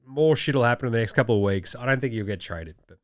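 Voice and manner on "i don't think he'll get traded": normal talking voice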